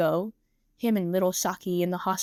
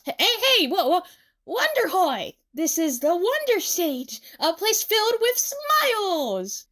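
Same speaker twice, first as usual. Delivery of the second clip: high, loud theatrical announcement; grand showman; 11 semitones higher, 4 dB louder, slower than usual. Acted. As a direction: proclaim it like a stage star, stretching the big words. Showman interrupts crying with stage greeting.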